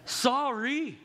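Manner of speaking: "sorry" high, last syllable leaned on; mock apology, "sorry" pushed high on its final syllable; insincere apology. Preacher mimics a spouse's unreal apology, insincere high "sorry". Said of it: "Whenever we emphasize the last syllable".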